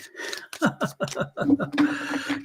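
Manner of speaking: high, airy run of chuckles; laughing. Real, not acted. Host laughs after long tangent.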